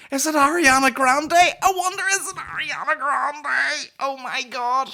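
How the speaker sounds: high squealed guessing, slowing at the end; hammed-up falsetto wonder; mock excitement. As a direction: imitate a giddy panellist losing it. Mimics excited TV show guessing.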